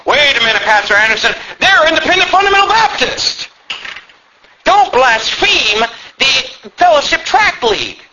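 high-pitched mock protest, stretched out at the end; outraged objection. Church member objecting to Pastor Anderson, mock outrage. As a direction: act out a scandalized church member.

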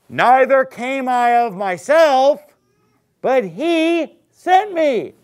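loud, high, slow scripture declaration; ringing pulpit proclamation, drawn out; emphatic preaching. Real, not acted. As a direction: drive each word home, slowly and forcefully. Preacher hammering the key verse.

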